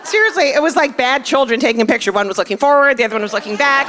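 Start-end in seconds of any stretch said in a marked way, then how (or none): none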